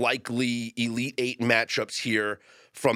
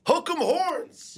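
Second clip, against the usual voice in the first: very high, slow drawn-out cheer; frat bro; much slower than usual. Real, not acted. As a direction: stretch the chant out like a fan. Texas fan cheer.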